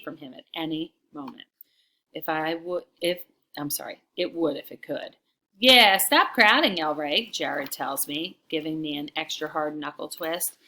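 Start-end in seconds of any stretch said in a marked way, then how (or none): 5.6-7.3 raised, slow jeering order; loud bully's complaint; loud taunt. A narrator's reading. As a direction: push him off with a loud, high jeer. Reads bully's taunt in story.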